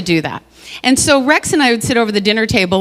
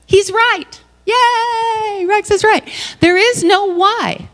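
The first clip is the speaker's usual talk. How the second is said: much higher, delighted and celebratory; joyful discovery; 12 semitones higher, slower than usual. Retold or imitated. Admitting her partner was right, high and delighted. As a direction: cheer it like a eureka moment.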